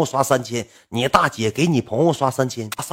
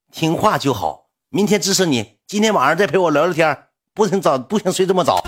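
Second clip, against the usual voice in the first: high, louder put-on coaxing; mock pleased master; 8 semitones higher, 6 dB louder than usual. Supporter rewarding the obedient streamer, high mock praise. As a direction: approve in a raised, indulgent voice. 演对方得意地吩咐.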